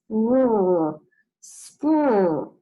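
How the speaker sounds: teacher sounding out the blend. Shown as a demonstration. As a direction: spur said twice, held. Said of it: "sound out the beginning part".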